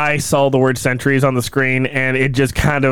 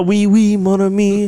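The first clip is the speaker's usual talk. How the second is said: mock French accent; 6 semitones higher than usual. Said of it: "A little bit Borat-y".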